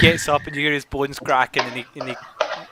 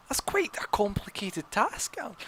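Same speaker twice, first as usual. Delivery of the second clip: whispered, slower aside; whispering; 7 semitones higher, 7 dB quieter than usual. Acted. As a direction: hush it like a secret. Unvoiced aside.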